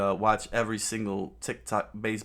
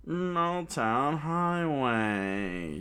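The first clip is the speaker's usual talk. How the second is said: very slow, drawn-out named attack; villain's move call; 6 semitones higher, much slower than usual. Acted. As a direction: stretch the move name out. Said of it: "she screamed".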